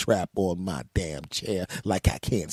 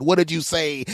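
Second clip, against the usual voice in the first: sharp, higher and louder challenge; demanding; 8 semitones higher, 6 dB louder than usual. Retold or imitated. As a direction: confront him, what did you say. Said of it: "And I said".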